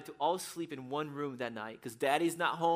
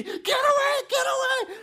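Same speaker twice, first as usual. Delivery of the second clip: high, full-force acted scream; screaming mother; 11 dB louder than usual. Mom fighting off a supposed thief, screaming. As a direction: scream it to drive off an intruder. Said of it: "like, screaming".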